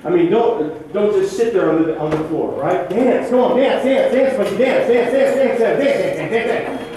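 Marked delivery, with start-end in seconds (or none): 2.9-7.0 quick repeated rhythmic commands; chanted coaching cues; urging on. Real, not acted. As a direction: egg them on, higher-pitched, beat by beat. Director urging choir to dance in rhythm.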